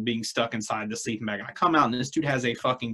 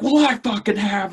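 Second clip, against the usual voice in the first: higher, louder, defiant confession; defiant friend; 7 semitones higher, 5 dB louder than usual. Retold or imitated. Drunk friend admitting it loudly, defiant. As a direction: blurt it out like a drunk challenge.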